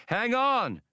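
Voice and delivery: high sharp call of warning. A narrator's reading. Hasty warns the others of danger, high urgent call. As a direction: pitch it up high as an alarm. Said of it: "warned Hasty".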